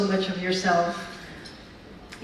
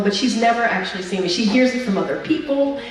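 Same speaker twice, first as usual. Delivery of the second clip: ordinary talk, nothing marked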